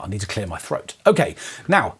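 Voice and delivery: usual speaking voice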